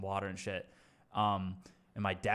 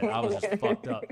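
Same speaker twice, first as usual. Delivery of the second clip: high, loud squeal of amusement; real laughter pushing the voice high; laughing admission; 12 semitones higher, 9 dB louder than usual. Real, not acted. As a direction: crack up while admitting it. Laughing at his own state.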